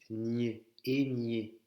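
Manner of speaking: NIÉ with the French GN sound; Ñ comme GN. Shown as a demonstration. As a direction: model et nié slowly as "égné". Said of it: "C'est comme le G N français". (ñ prononcé gn)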